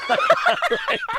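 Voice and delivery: high burst of loud laughter; big real laugh, high and loud; cracking up at his own punchline. Real, not acted.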